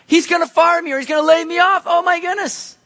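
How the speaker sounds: high, loud imitated panic; mock panic. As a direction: act out fear of being fired. Dreading the boss's office.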